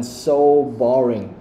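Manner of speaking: slow, drawn-out "so" before "boring"; stress on so. Shown as a demonstration. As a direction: stretch and stress "so". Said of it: "You have to stress".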